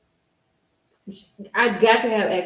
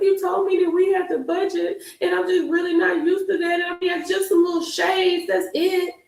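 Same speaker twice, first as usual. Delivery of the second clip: retold crying plea; 10 semitones higher than usual. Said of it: "I was just crying".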